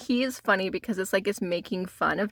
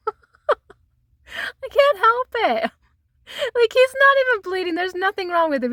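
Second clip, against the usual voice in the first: laughing; 11 semitones higher, 3 dB louder than usual. Real, not acted. Giggling at the Ten of Swords.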